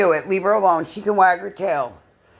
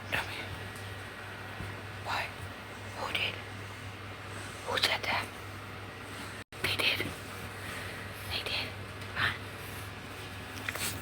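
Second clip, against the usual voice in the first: hushed secret whisper; 15 dB quieter, slower than usual. Real, not acted. Nana whispers secrets with her dog. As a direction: whisper like sharing gossip.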